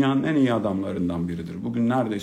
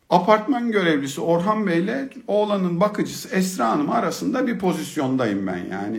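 usual speaking voice

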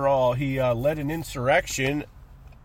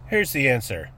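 usual speaking voice

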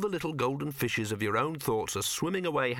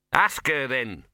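smug; 3 semitones higher, 6 dB louder, slower than usual. A narrator's reading. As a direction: throw it out smugly. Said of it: "said the dog smugly".

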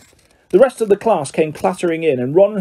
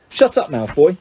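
angry. A narrator's reading. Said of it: "said Harry angrily".

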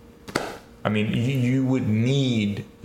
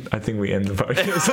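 ordinary delivery

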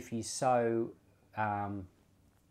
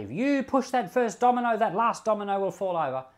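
much higher and louder, declaring; predicting voice; 9 semitones higher, 6 dB louder than usual. Retold or imitated. Preacher voicing a prophet's warning, raised and declaring. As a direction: announce the outcome like a warning. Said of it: "if I was to say".

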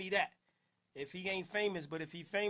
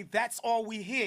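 ordinary delivery

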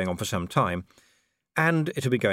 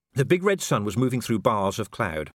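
ordinary delivery

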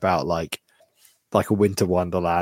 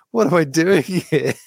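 higher, louder mock despair; a hapless elf actor wondering why he's there; dismayed actor; 6 semitones higher, 4 dB louder than usual. Poor elf actor facing crying kids, in higher, louder mock despair.

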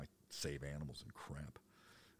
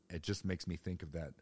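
plain reading voice

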